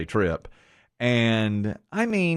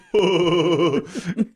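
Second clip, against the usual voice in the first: laughing; 6 semitones higher, 4 dB louder than usual. Real, not acted.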